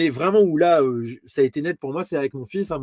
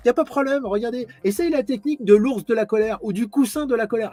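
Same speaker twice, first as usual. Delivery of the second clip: much higher, sing-song advice-giver voice; mock parenting expert; 7 semitones higher than usual. Father parodying a parenting expert to parents, high sing-song mock voice. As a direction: parody the cheerful expert explaining. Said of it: "Et tu vois".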